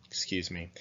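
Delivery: unvoiced quick excuse me; whispered aside. Real, not acted. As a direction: whisper it to yourself.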